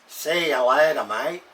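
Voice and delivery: light put-on Aussie farewell; Australian accent. Shown as a demonstration. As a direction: sign off like an Aussie. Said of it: "And the Aussies say".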